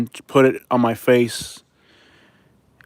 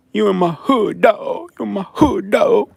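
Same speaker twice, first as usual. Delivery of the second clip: high, drawn-out mocking threat; mock a street heckler in a high, stretched voice; 8 semitones higher, slower than usual. Streamer mimics a death threat from Tuskegee, mocking high-pitched tough guy. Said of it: "One of those people".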